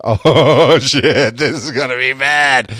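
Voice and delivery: drawn-out wobbling "oh" into dread; mock dread. Drunk man realizing he will be sick, mock dread. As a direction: groan it out as trouble looms. Dreading his coming sickness, retold.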